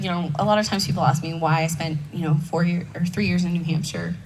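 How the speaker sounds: ordinary delivery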